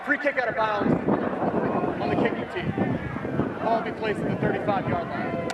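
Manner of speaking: referee PA announcement. Real, not acted.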